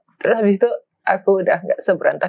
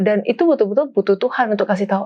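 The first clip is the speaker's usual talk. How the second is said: normal talking voice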